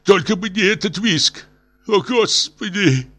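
very high, anguished wail; hysterical. A narrator's reading. Professor dreads his wife's screeching, hysterical wail. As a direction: start to go into hysterics. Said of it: "начиналась истерика".

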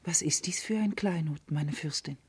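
soft, whispered question; hushed whisper. Acted. Karis to the princess, whispering. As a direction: keep it secret, barely voiced. Maid answering princess secretly.

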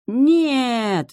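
высокий громкий крик «Нет!»; в отчаянии отказывается верить; отчаянный крик. A narrator's reading. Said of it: "в отчаянии". (отчаянный крик)

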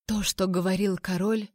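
ordinary delivery, nothing marked

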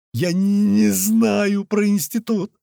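high, slow, stuttered denial; stammering. A narrator's reading. Threatened captive denies knowing the institute, stammering. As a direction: stick on the consonant, pitched high. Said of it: "вновь заикаясь, проговорил тот".

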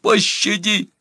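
very high, desperate cry; high-pitched begging wail; wailed plea. A narrator's reading. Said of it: "вопила она во всё горло".

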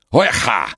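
strange throaty bark; guttural cry. Acted. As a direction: shout a weird guttural call. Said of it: "издал странный гортанный звук".

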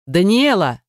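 name barked after her, drawn out; mild roar of a name; barked call. A narrator's reading. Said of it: "рявкнул он ей вслед". (рявкнуть вслед)

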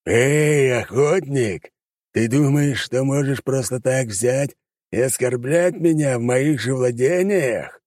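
high, slow demon voice, taunting; mocking demon. Demon mocks the hunter in his own domain, slow and sneering. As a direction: drag each word out like a scornful monster.

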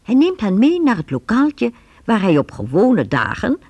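ordinary talk

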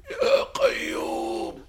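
high slow cry to heaven; anguished cry. Acted. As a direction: wail out a prayer. Priest cries out to God.

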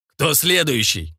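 slow, menacing challenge; drawn-out threatening snarl; enraged growl. A narrator's reading. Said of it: "разъярённый рык Демьяна".

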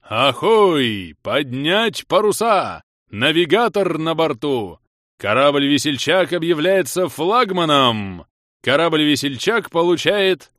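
loud, slow, booming ship's herald; commanding booming voice. Acted. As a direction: proclaim it like an authoritative captain. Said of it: "властный рокочущий голос".

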